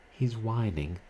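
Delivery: a touch of fear. A narrator's reading. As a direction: voice her nerves rising. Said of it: "she continued nervously".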